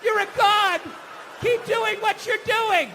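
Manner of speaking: high, gushing cheers; mock adulation. Admirer cheering on a passed-out alcoholic, mock gushing.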